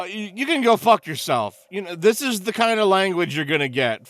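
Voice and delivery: usual speaking voice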